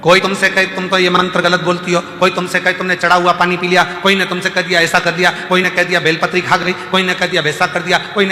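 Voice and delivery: rally the crowd with insistent, repeated phrases; impassioned preaching. Real, not acted. (जोशीला, ऊँचे स्वर का प्रवचन)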